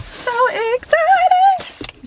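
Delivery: a long, high, loud squeal of delight; excited squeal. Real, not acted. Vlogger squealing to viewers over a gift, high and drawn out. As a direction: squeal it out, stretched slow and high.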